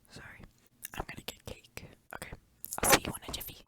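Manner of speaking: hushed unvoiced sign-off; whisper. Real, not acted. Leaving to get cake, whispering to viewers. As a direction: whisper it to the camera. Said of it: "we're just gonna whisper in this bitch".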